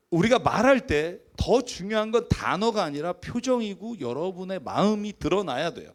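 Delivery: normal talking voice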